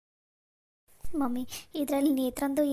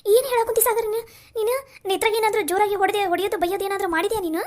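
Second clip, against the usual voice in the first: high, loud cry of dismay; panicked demand for the truth; alarmed; 6 semitones higher, 5 dB louder than usual. Acted. Sister to Sagar, alarmed and loud.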